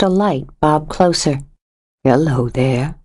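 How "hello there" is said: lightly voiced stranger, a bit lower and smooth; a hint of a friendly man calling out of the dark; low pleasant stranger. A narrator's reading. Stranger greets a woman outside at night, lightly lowered pleasant voice. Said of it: "The male voice was low and pleasant".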